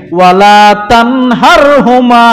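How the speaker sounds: slow, drawn-out chanted Arabic verse; ধীরে সুর করে কুরআন তিলাওয়াত; Quran recitation. Acted. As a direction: intone the verse slowly like tilawat.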